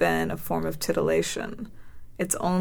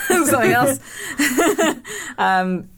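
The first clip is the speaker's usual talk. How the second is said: bursts of high laughter; laughing; 8 semitones higher, 5 dB louder than usual. Real, not acted. Laughing at the next topic.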